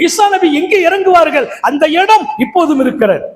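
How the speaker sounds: high, ringing sermon delivery; full-force preaching. Acted. Preacher tells the congregation where Jesus will be buried, full-force preaching. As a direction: preach it out with the pitch held high.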